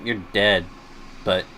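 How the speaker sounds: normal talking voice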